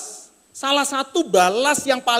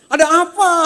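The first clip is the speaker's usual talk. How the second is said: blunt, loud Tangerang-style question; Tangerang accent; 7 semitones higher, 7 dB louder, much faster than usual. Retold or imitated. Preacher mimicking blunt Tangerang accent speech. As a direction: bark it out the Tangerang way. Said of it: "Gitu, kira-kira begitu".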